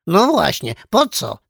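high, loud parrot echo. A narrator's reading. Parrot backing up the orangutan's question, echoing squawk. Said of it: "zawtórowała papuga".

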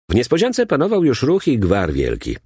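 plain reading voice

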